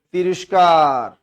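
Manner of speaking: loud, very stretched single word; drawn-out recap answer. Real, not acted. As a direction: stretch the word out loudly for the class. Answering own recap question for class. (জোরে টেনে টেনে বলা)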